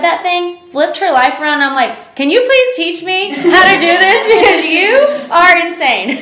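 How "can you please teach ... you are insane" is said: high, loud, laughing plea; excited pleading. Retold or imitated. To her friend, asking to learn manifesting: high laughing plea.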